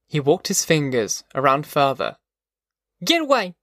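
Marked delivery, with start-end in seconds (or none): 3.0-3.6 put-on high-pitched girl fending him off; angry cry. A narrator's reading. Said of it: "she cried".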